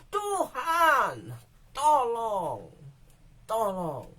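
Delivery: high crying start fading to weak; plea dying away; fading desperate plea. Acted. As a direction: cry out then run out of strength. Voiced prayer in emergency.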